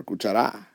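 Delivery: "cuchara" wrongly stressed on the final ra; stress on last syllable. Shown as a demonstration. Teacher showing another wrong stress, "cuchara" accented on ra. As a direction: push the stress to the end of "cuchara". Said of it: "we don't pronounce it cuchara or".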